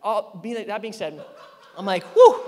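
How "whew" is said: loud "whew" blown out; loud, high puff of relief; shaken exhale. Retold or imitated. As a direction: let the panic out in one whoop. Acts out calming down after yelling.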